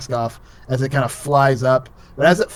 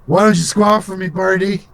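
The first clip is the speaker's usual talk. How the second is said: put-on higher-pitched thick-accented drunk bugbear taunt; drunken goblinoid; 6 semitones higher than usual. Drunk bugbear taunts the cockatiel mask, thick accent. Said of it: "it's a really thick accent".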